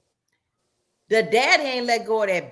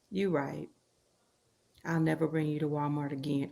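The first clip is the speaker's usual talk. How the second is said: sheepish; 9 semitones lower, 9 dB quieter than usual. Retold or imitated. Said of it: "he says".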